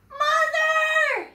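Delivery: piercingly high, loud cry; panicked little boy. A narrator's reading. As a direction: scream for mother as a child. Boy runs in frightened.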